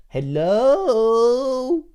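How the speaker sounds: loud, high, drawn-out hello; hammed-up pleading shout; desperate calling out. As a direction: call out while banging on a shut window. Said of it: "Banging on the window".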